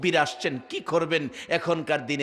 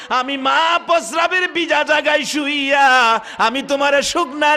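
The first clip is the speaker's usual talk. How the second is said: high, chanted wail of reproach; acted maternal lament, pitched high; wailing mother; 8 semitones higher, 7 dB louder than usual. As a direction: cry out to the son in long melodic phrases. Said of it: "কান্দে আর ডাকে".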